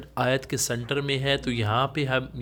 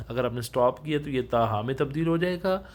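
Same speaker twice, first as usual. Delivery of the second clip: usual speaking voice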